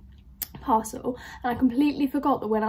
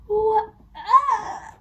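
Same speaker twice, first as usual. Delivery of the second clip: high, sharp startled cry; mock shock mid-fall; startled yelp; 10 semitones higher, 6 dB louder than usual. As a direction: yelp as the floor vanishes. Grabbing the rail as she falls.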